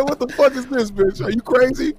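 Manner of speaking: high, laughing indignant outburst; mock outrage. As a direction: play it like comic fury. Acting out outraged reaction.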